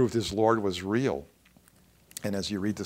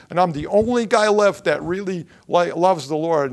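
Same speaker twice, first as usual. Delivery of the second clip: loud, higher complaint; aggrieved protest; 5 semitones higher, 7 dB louder than usual. Acted. Elijah complains to God he alone is left, loud protest. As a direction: insist on it with a raised voice.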